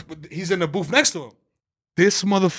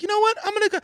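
imitating a casual guy; 13 semitones higher, faster than usual. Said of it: "It's not like a guy that's like".

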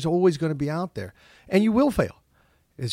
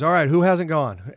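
teacher calling out; 4 semitones higher, 7 dB louder than usual. Retold or imitated. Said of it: "And he goes".

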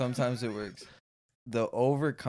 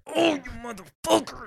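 mock yell; 12 semitones higher than usual. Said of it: "And I hear".

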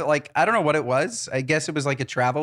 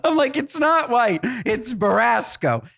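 higher, laughing through it; 8 semitones higher, slower than usual. Real, not acted. Retelling the snobby correction, laughing high. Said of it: "I corrected her".

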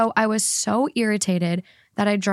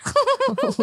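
real laugh, far above speaking pitch; high-pitched laugh. Real, not acted. Friend cracking up at the argument story, laughing.

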